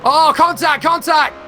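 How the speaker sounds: sudden high yell at a crash; excited shout. Real, not acted. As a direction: shout it out as the cars touch. Crash seen live in race.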